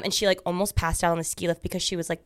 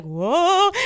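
startled whoa; 9 semitones higher, 4 dB louder than usual. Retold or imitated. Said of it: "I was like".

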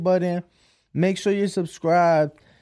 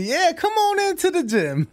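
mock invitation; 11 semitones higher than usual. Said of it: "you think it's gonna be".

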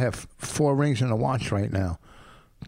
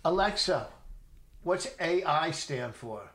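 talking to voice assistant; 3 semitones higher, 7 dB quieter, slower than usual. Real, not acted. Asking a voice assistant.

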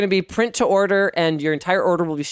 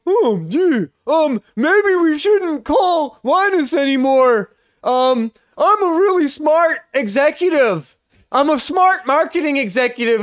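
mock executive voice; 7 semitones higher than usual. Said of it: "you guys would go".

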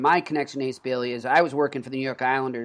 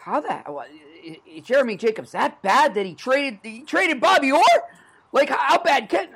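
higher, louder mock disbelief; incredulous youngster; 10 semitones higher, 4 dB louder than usual. Imagined young fan asking about the trade, incredulous. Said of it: "you probably wonder".

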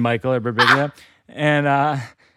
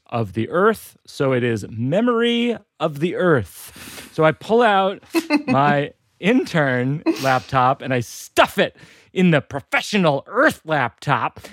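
ordinary talk, nothing marked

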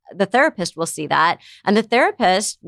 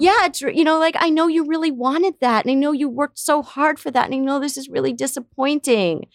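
higher, tender validating tone; empathetic validation; 7 semitones higher than usual. Shown as a demonstration. Parent validating a disappointed child, tender. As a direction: comfort the child by naming the loss. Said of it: "so you can say to them".

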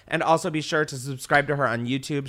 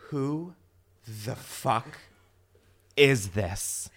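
shocked disbelief; 6 dB louder, slower than usual. Retold or imitated. Shocked reaction in school story.

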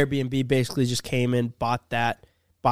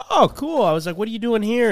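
higher, louder upbeat reaction; retold friendly enthusiasm; 9 semitones higher, 5 dB louder than usual. Chatting with a Canadian stranger at the bar, replayed with enthusiasm. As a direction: act out a keen, impressed reply.

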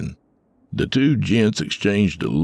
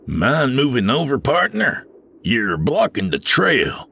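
glaring driver; 8 semitones higher than usual. A narrator's reading. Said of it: "glaring at the stranger".

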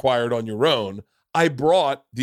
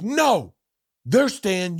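higher mock doorman; bouncer ordering; 9 semitones higher, 4 dB louder, much slower than usual. Bouncer voiced in retold story.

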